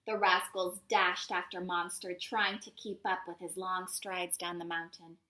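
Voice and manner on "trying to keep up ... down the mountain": plain reading voice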